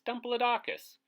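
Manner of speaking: Dumplodocus said high and loud, bouncing; playful high repeat. Shown as a demonstration. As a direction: sing out Dumplodocus for fun. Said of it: "His name is fun to say".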